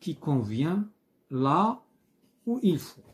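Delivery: reads the exercise instruction slowly; slow reading. Shown as a demonstration. Teacher reading exercise instruction aloud, slow. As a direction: read out the exercise title word by word. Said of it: "اون باس ا لا باج سويفونت".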